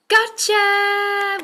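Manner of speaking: high, loud triumphant gotcha; excited gotcha. Shown as a demonstration. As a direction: pounce on it with glee. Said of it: "What happens when we find it?".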